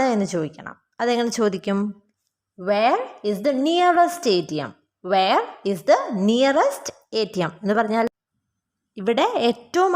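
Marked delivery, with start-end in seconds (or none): 2.6-7.5 slow, measured question for learners; slow model sentence. Shown as a demonstration. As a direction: say it slowly, phrase by phrase. Teacher models English question slowly.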